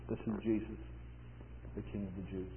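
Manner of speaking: very soft, low recitation; solemn hush. Retold or imitated. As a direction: drop low and let each phrase rest. Hushed reading of the inscription.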